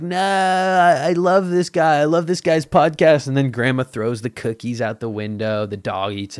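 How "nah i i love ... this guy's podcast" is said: high, loud mock protest; hammed-up pleading fan, pitched up; defiant grandchild. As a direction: shout back in defence of the podcast. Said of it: "And then you're like".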